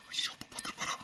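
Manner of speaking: hushed, voiceless aside of alarm; barely audible worried whisper; panicked whisper. Acted. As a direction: whisper it so father can't hear. Alarmed aside, father is nearby.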